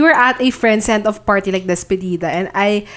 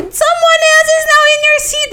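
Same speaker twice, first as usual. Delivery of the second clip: much higher tipsy screech; mock scream; 3 dB louder than usual. Screaming at the friend who left her seat, tipsy wail. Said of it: "screaming at her".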